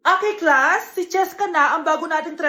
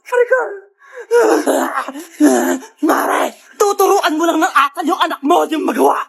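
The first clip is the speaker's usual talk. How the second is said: furious challenge; 6 dB louder than usual. Acted. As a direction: square up for a fight. Mother challenges the other. (galit na hamon)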